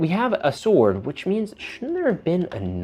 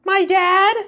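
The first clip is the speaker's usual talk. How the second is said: put-on kid figure voice. Kid Pool answers.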